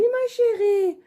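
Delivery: high cooing to a darling; high sweet-talk. Real, not acted. Answering her child or pet.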